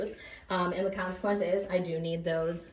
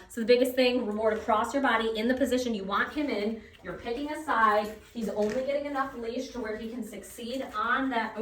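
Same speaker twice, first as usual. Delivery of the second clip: ordinary delivery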